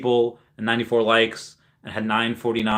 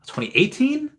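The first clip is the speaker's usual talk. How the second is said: high unsure question; 11 semitones higher than usual. Real, not acted. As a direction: pitch jumps way up, doubtful. Unsure about year.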